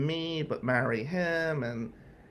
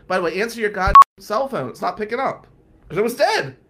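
loud scolding; 7 semitones higher, 9 dB louder, faster than usual. Retold or imitated. Friend scolding in voicemail.

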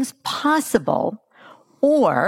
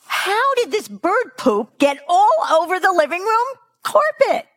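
angry shout; 12 semitones higher than usual. Retold or imitated. Mother finds bird poop on her carpet, outraged shout. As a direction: shriek at the mess on the floor. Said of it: "My client Hannah shouted".

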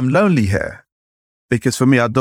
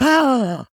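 put-on high shocked shout of children; a sudden high gasp of alarm; class gasp; 12 semitones higher than usual. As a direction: kids crying out as the thing breaks. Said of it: "all the children are like".

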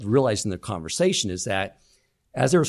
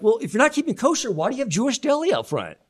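exasperated friend; 10 semitones higher than usual. Retold or imitated. Friend challenging deli owner.

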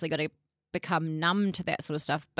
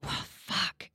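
breathy whispered curse; retold whispered oh fuck on seeing the blood; whispered dread; 9 dB quieter than usual. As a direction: whisper it under your breath in horror. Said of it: "And I was like".

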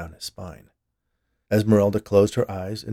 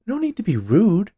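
high, loud wifely reproach; chiding wife; 12 semitones higher, 8 dB louder than usual. A narrator's reading. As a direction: scold your husband in a higher voice. Wife chides her husband.

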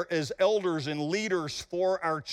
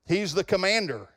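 ordinary talk, nothing marked

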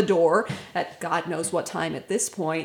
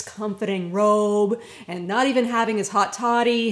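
ordinary talk, nothing marked

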